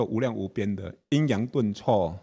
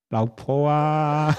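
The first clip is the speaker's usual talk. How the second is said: tender call; 5 semitones higher, 5 dB louder, much slower than usual. Pretend. Calls wife sweetly mid-quarrel.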